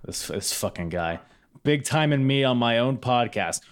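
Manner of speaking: ordinary talk, nothing marked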